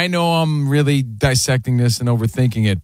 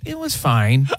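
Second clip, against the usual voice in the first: flat; 3 semitones lower, slower than usual. Retold or imitated. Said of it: "what'd you think of that movie".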